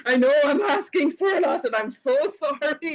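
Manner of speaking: mock sheepish pleading voice; nervous apologetic self. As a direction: replay the timid apology, with a nervous laugh on sorry. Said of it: "You should have heard me on the phone call".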